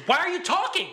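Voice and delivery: high, loud retold outburst; exasperated mock yell. Fed-up moviegoer to talkers in the theater, exasperated mock yell. Acts out outburst he almost made.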